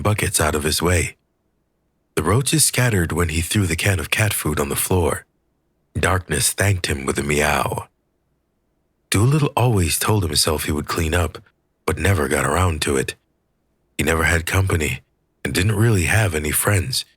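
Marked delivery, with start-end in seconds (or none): none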